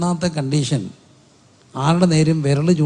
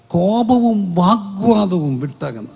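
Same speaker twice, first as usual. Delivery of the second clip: slow, raised preacher's reading; emphatic scripture quote; 4 semitones higher, 4 dB louder, slower than usual. Real, not acted. Preacher repeats the verse to the congregation, slowly and emphatically. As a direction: draw out each key word of the verse.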